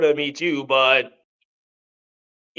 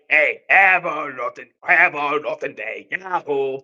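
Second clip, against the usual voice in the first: Wario voice; 4 semitones lower, 4 dB quieter than usual. Retold or imitated. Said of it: "said in my Wario voice".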